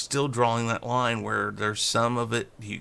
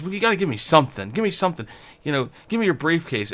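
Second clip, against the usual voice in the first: pushy beggar; 7 semitones higher, faster than usual. Retold or imitated. Beggar demanding briefcase.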